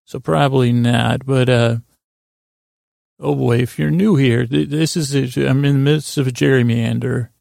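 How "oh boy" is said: ordinary delivery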